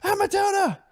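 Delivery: put-on high squealing little kid yelling at a bunny; daughter's excited scream. Dad imitating his kids spotting the bunny, high excited squeal. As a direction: squeal it like a thrilled toddler. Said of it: "My daughter's scream".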